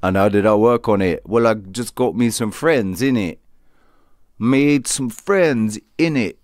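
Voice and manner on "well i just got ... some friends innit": London street accent. Shown as a demonstration. Showing how his friends spoke, in a London street accent. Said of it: "people what spoke like this".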